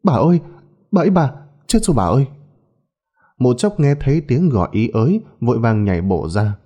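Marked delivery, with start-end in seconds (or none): 0.0-2.3 high, rushed call for help; panicked cry. A narrator's reading. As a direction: cry out in alarm to the mistress. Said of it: "tiếng Miên kêu lên thất thanh".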